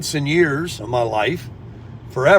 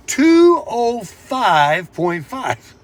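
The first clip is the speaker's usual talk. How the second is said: Two oh five point five drawn out slowly, higher; slow dramatic reveal; 7 semitones higher, much slower than usual. Real, not acted. Announcing his weigh-in to viewers, slow and suspenseful. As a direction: reveal the number word by word like a drumroll. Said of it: "You ready?".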